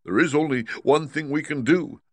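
high-pitched, firm lion voice; resolute Cowardly Lion. Acted. Lion answering Dorothy with a plan, high and resolute.

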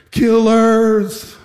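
high, long-held imitated cry; retold yell. Inmates yelling at the guards doing CPR, imitated yell. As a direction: call the accusation out high and drawn out. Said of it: "They started yelling at us".

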